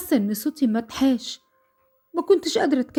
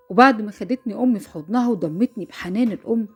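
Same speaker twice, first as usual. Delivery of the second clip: ordinary delivery, nothing marked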